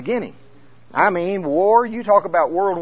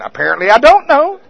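high, loud, laughing; sheepish laughing admission; 10 semitones higher, 10 dB louder than usual. Retold or imitated. Preacher mocking his own ignorance, high and laughing. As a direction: laugh it off, caught out.

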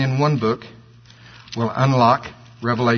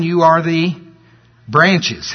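"branches" alone after a deliberate gap; stress on branches; much slower than usual. Shown as a demonstration. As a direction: pause before "branches" and set it apart. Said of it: "gonna emphasize the word branch".

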